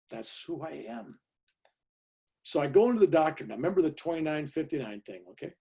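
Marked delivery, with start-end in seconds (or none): 0.1-1.2 slow, soft, unbothered delivery; calm reply. Retold or imitated. Patient answering the alarmed nurse, acted unruffled calm. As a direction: answer softly, taking your time. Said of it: "you're so calm".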